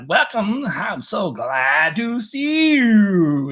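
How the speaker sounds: high, loud, hugely drawn-out swoops; wacky AI voice stretching words absurdly; talks like a whale. Retold or imitated. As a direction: moan the greeting like whale song. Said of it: "It talks like a whale".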